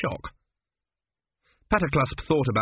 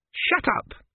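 much higher, snapping order; sharp order. Acted. Exasperated queen silencing her priest, sharp. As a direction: shut him up at once.

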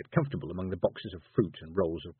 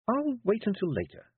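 put-on cool young girl; 12 semitones higher than usual. Tiffany deflecting.

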